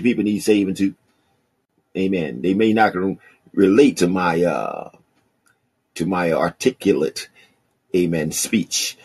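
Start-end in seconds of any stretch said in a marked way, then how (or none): none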